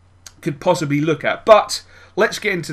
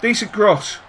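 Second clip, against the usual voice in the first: ordinary talk, nothing marked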